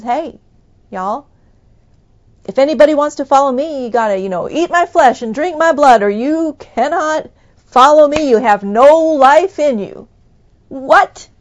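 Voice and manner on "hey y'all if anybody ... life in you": loud, higher call to the crowd; Jesus calling out. Retold or imitated. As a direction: proclaim it boldly to everyone following. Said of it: "Jesus kinda turns around and says".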